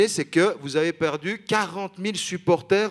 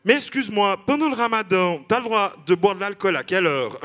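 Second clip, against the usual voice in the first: naive questioner; 5 semitones higher than usual. Retold or imitated. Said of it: "qui te dit".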